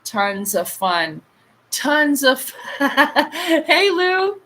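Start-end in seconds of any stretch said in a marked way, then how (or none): 1.7-4.4 high laughing greeting; slow, loud, laugh-broken hello. Real, not acted. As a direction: break into giggles while calling out hello. Laughs while greeting chat.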